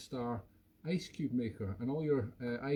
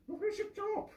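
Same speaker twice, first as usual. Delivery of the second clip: much higher, sing-song voice to the dog; high doggy talk. Real, not acted. Owner teasing the dog about its lost toy, squeaky pet talk.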